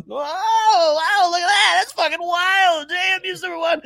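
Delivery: high-pitched wowed reaction; exaggerated, high "whoa" of disbelief; mock amazement. Imagined shocked reaction to the rankings.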